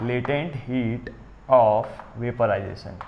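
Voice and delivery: very slow, drawn-out term; slow dictation. Real, not acted. Teacher writes a new heading for the class, slow dictation. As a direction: spell out the heading at writing speed.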